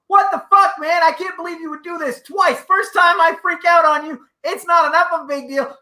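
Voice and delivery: angry shout replayed for laughs; retold screaming. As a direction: scream it in his face as he remembers. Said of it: "I start screaming in his face".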